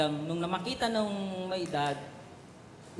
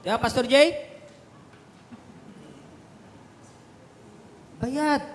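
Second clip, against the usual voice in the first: high and loud, building to a shout on Libre; full-force preaching; 5 semitones higher, 6 dB louder than usual. Acted. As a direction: preach it hard to the congregation. (malakas at mataas na pangangaral)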